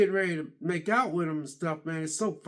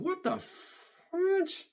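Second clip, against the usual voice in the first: very high, slow, drawn-out gasp of disbelief; mock shocked exclamation; 12 semitones higher, 5 dB quieter, much slower than usual. Recalling watching the scene, high drawn-out mock shock. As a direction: stretch it out in playful shock.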